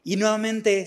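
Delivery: ordinary talk, nothing marked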